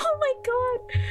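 high laughing "oh my god"; laughing exclaim. Real, not acted. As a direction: burst out laughing in delight. Laughing delight at image.

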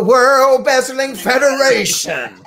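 big, loud wrestling announcer boom; Mean Gene Okerlund. Acted. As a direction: draw the words out like a ring announcer. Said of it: "trying to sound like Mean Gene Okerlund".

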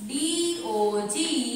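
very slow, stretched letters; letters drawn out one by one; spelling out. Shown as a demonstration. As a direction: spell it out as you write. Teacher spells while writing it.

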